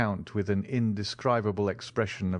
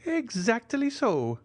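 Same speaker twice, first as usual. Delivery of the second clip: put-on high, slow, delighted old major; slower than usual. A narrator's reading. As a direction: savor the agreement with delight. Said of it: "said the delighted major".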